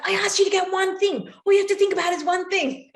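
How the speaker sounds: high, loud furious snapping; exasperated yell. Retold or imitated. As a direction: let the whole day's tension burst out. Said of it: "Jack's mum's like".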